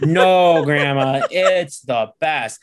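put-on little boy assuring grandma the movie is fine; childlike insistence. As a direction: plead like an excited little kid.

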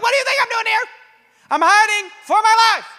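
frantic, high-pitched shouting at God; freaking out. Acted. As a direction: shout back in hammed-up panic. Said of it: "Elijah is freaking out".

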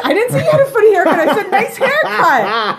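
laughing. Real, not acted. Laughing retort.

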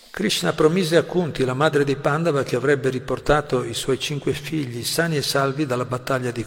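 projected scripture reading; reading aloud from a text. Acted. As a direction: proclaim the passage to the listeners.

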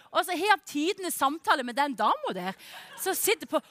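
normal talking voice

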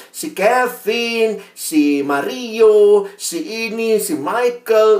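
high, slow, drawn-out listing; child listing names. Retold or imitated. Kindergarten niece lists her boyfriends, mock-childish drawn-out high voice. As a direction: stretch each name high like a small kid.

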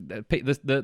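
ordinary talk, nothing marked

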